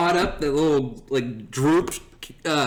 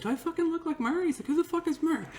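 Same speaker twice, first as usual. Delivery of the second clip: high, hushed mock bafflement; imitated indignation; 7 dB quieter, faster than usual. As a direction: question it in a softer, high voice. Announcer realizes he is misnamed.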